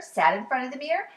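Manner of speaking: usual speaking voice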